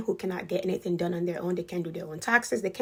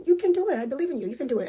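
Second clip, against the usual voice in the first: encouraging; 7 semitones higher, 5 dB louder, much faster than usual. Retold or imitated. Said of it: "she'll be like".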